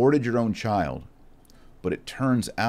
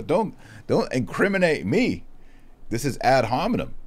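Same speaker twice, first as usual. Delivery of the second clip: higher, lightly voiced offended objection; indignant retort; 6 semitones higher than usual. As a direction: protest a touch defensively. Retold heated coffee shop exchange.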